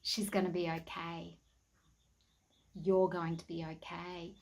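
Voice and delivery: soothing her like a quiet reassuring voice; calm inner voice. Retold or imitated. An inner voice reassuring her about the calf, calm and soft. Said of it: "in such a calm voice".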